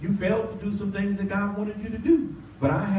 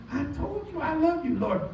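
agitated; 11 semitones higher, 3 dB quieter than usual. Acted. Peter's agitated reply voiced.

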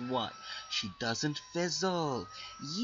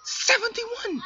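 loud high disbelieving shout; outraged; 13 semitones higher, 6 dB louder, slower than usual. Real, not acted. Gamer outraged at opponent's stats, shouting. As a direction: yell the number in outrage.

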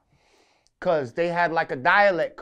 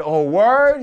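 loud, high mock surprise; surprised; 7 semitones higher, 11 dB louder than usual. Hearing his clips teach a New York accent, loud surprise. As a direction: blurt it out amazed. Retold surprise at the coach's news.

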